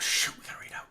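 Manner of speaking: soft, voiceless hush; shush, then whisper it. Pretend. Streamer choosing whom to raid, whispering. Hushed repeat while picking raid.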